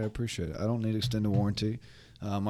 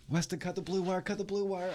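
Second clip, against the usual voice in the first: much higher, tense action-movie plea; high-pitched urging; 9 semitones higher, 4 dB quieter than usual. Pretend. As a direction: urge him like a bomb scene. Mock bomb-defusal urging.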